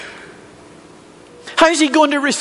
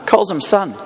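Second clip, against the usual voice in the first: normal talking voice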